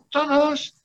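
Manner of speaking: high mocking squeak on "Todos"; sarcastic. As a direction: toss it off with mock cheer. Sarcastic answer to own question.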